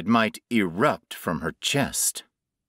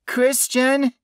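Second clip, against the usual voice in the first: louder, much higher frightened call; shaking voice; 5 dB louder than usual. A narrator's reading. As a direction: call his name in rising fear. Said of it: "Her voice shook along with the rest".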